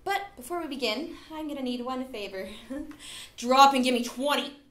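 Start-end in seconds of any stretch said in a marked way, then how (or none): 3.4-4.6 barking gym coach. Acted. Host orders auditioners like drill.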